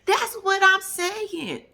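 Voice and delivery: drawn-out high exclamation; emphatic agreement. Real, not acted. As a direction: stretch it out loud and high.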